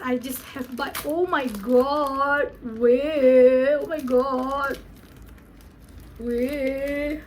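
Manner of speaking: ordinary delivery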